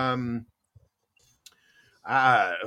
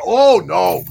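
big, much louder playful protest; mock loud refusal; 3 semitones higher, 12 dB louder than usual. Homeowner to wood delivery men offering to stack, mock loud protest. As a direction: turn down the offer with hammed-up volume.

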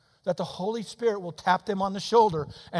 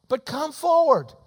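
louder, drawn-out final appeal; slow pleading preach; 8 semitones higher, 8 dB louder, slower than usual. Acted. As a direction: plead slowly for them to step out.

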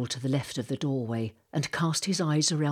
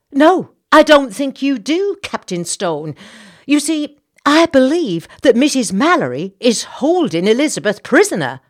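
much higher, louder, scandalised lady; indignant society lady; 9 semitones higher, 13 dB louder than usual. Acted. Senator's wife complaining about Missus Mallory, high and indignant. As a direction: huff it out with outrage. Mrs Bartlett insists.

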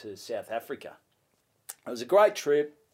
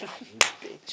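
mock betrayal; 4 dB louder than usual. Betrayed by laughing fiance.

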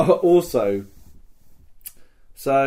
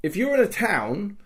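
indignant outburst; 7 semitones higher, slower than usual. Real, not acted. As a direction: spit it out in real outrage. Indignant about being denied badge.

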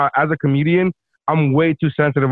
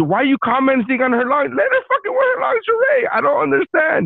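outraged rant; 10 semitones higher than usual. Retold or imitated. Voicing her outraged fan reaction.

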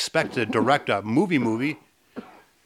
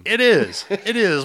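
laughing yes; 8 semitones higher, 7 dB louder, slower than usual. Real, not acted. Laughing agreement.

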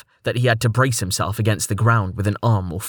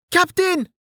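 high alarmed call; shout. Acted. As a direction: cry out in alarm. Someone shouts warning as ship falls.

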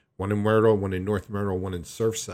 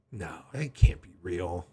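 ordinary talk, nothing marked

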